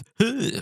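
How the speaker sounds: short high laugh. Real, not acted. Laugh after mocking impression.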